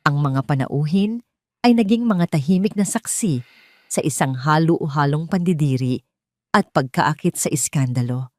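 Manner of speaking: ordinary delivery, nothing marked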